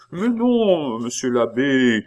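high wailing denial; alarmed protest. A narrator's reading. Pitou denying it to the abbé, alarmed. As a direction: plead innocence, pitched right up.

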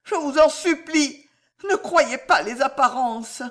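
high, desperate begging; faltering plea. A narrator's reading. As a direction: implore in a frightened high voice. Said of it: "balbutia-t-elle".

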